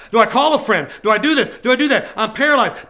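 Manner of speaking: hammed-up frantic indecision; acted desperation. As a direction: pile up options in mock panic. Acts out being stuck, not knowing.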